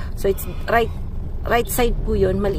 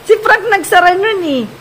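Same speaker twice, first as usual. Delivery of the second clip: calling out; 10 semitones higher, 9 dB louder than usual. Real, not acted. Calling out across house.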